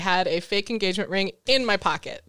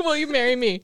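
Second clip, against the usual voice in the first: laughing; 2 semitones higher, 4 dB louder than usual. Pretend. Mock proposal amid laughter.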